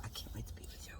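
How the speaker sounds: barely audible whispered endearment; intimate whisper. Real, not acted. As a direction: whisper it privately to your beloved. Private aside to her divine husband.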